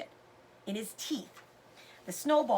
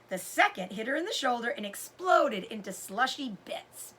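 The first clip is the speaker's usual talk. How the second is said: usual speaking voice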